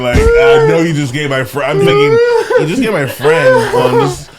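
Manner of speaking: high, loud mock plea through laughter; laughing plea. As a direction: beg the manager while cracking up. Pleading with manager, laughing.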